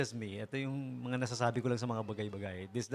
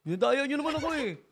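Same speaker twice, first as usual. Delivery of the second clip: put-on much higher, louder, sulky child who lost at hide and seek; whiny cheated child; 10 semitones higher, 5 dB louder than usual. DJ acting a cheated child at play, whining high and loud. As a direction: whine like a kid who got cheated. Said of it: "nakikipaglaro ka nung bata ka".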